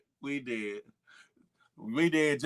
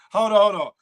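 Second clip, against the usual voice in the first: sudden interruption; 3 semitones higher, 11 dB louder, faster than usual. Real, not acted. Cutting himself off.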